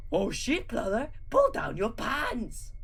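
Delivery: hurled, high and hurried; urgent yell. Acted. Drew shouting at Gru to drop his pants. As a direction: fling the order at him. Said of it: "hurled Drew".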